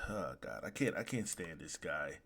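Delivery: low, soft groan of dread; weary exasperation. Real, not acted. Fan sighing over a character they hate, weary exasperation. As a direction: sink down quietly, fed up.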